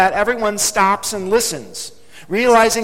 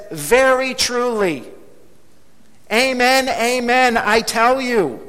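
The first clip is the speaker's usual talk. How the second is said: slow, raised, ringing declaration; emphatic proclamation; 4 semitones higher, slower than usual. Acted. Preacher quoting Jesus' double amen, emphatic proclamation.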